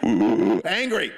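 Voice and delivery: a loud, hammed-up angry growl of one word; mock angry grunt. Preacher imitating the angry seatmate, loud mock growl. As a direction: act out the passenger's loud wordless anger. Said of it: "angry, like".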